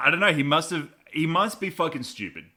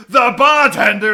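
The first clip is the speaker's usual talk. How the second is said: hammed-up shout, much higher and louder, slow; mock shout; 11 semitones higher, 15 dB louder, slower than usual. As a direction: yell the guess like a triumphant Travolta. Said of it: "He goes".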